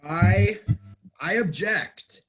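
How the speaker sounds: firm courtroom "I object"; courtroom objection. Shown as a demonstration. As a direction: call it out like a lawyer in court. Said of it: "a courtroom, a legal phrase".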